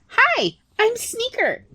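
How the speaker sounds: easy, breezy yes; high little rat voice; chirpy rat. Acted.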